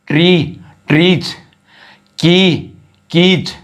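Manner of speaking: ट्रीज and कीज ending in a voiced z; plural with z sound. Shown as a demonstration. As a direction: stretch the final z on ट्रीज and कीज. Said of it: "ज की ध्वनि आती है".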